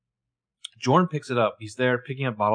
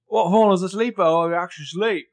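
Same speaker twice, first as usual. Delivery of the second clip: put-on a half-hearted Australian accent, high and a bit louder; attempted Australian accent; 7 semitones higher, 5 dB louder than usual. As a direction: try an Australian guy's voice and don't quite land it. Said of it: "I cannot do his voice".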